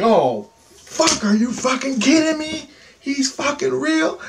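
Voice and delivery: high, strained cry of disbelief; high-pitched, incredulous retold outburst; mock yell. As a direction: re-enact a panicked yell at contained volume. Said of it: "I'm swearing, I'm cursing, I'm yelling".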